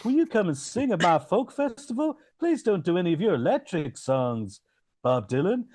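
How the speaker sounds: put-on a fussy old folkie pleading.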